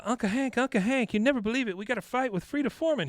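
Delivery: high, eager calling of Uncle Hank; excited niece. Retold or imitated. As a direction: bubble over with the news. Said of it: "She goes".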